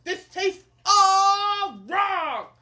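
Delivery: loud, high, drawn-out complaint; hangry king. Acted. Hungry king rejects his food, hangry. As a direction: roar it slowly in disgust. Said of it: "It's hangry".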